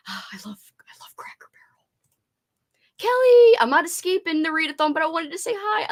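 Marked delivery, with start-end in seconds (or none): 0.1-1.8 whisper. Real, not acted. Longing for Cracker Barrel.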